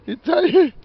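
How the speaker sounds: high teasing coo to a dog. Real, not acted. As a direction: coo at the pet, high and loud. Cooing at tired dog.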